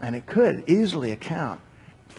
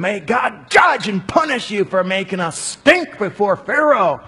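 loud, much higher angry outcry; furious curse; 8 semitones higher, 6 dB louder than usual. Acted. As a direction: hurl the curse at them. Foremen curse Moses and Aaron.

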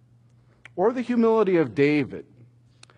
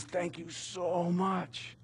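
hushed thanks; 2 semitones higher, 13 dB quieter, much slower than usual. Acted. Pharmacist thanks the boy.

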